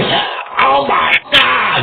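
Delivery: high, loud, slow cry of shock; mock shocked shout of the opponent; freaked out. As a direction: cry out like he's terrified. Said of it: "The guy will be".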